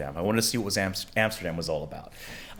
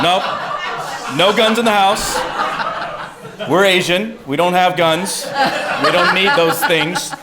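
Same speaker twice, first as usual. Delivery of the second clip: loud, raised-pitch firm no; mock parental refusal; 8 semitones higher, 6 dB louder than usual. Parents refusing their son guns, loud and firm. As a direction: lay down the house rule loudly.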